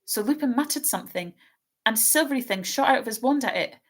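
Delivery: put-on a nervous young girl stumbling over her words; anxious girl's voice. Hermione's voice in reading.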